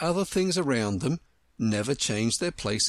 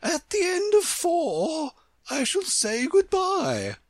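imperious Queen, instructing. A narrator's reading. Queen giving Alice her directions, high imperious voice. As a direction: proclaim each step high and deliberately.

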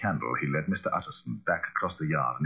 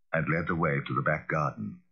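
usual speaking voice

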